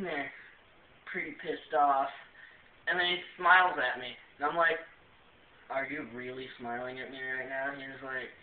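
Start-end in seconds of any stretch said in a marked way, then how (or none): 5.7-7.7 quiet irritated disbelief. Retold or imitated. Pissed off, confronting the smiler.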